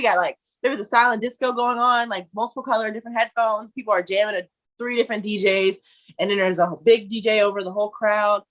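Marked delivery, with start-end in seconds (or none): none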